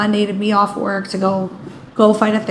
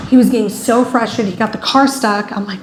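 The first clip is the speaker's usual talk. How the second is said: ordinary delivery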